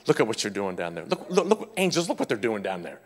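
quietly amused God pointing to the angels; a hint of God chuckling; voicing God. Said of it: "God's in heaven saying".

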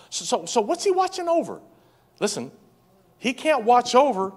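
high, loud preaching climb; preaching at full force. Acted. Pastor to congregation, preaching high and loud. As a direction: lift the room, preaching hard.

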